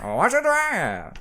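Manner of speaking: slow high vintage ad voice; drawn-out game show announcer; old-timey announcer. Pretend. Echoing the old-timey ad bit.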